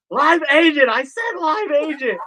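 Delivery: high, loud frustrated demand; angry yell. Retold or imitated. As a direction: insist again, losing patience. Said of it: "I'm getting madder and madder".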